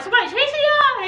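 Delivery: high, fast, startled asking; surprised question. Retold or imitated. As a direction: play a shocked bystander. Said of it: "They was like".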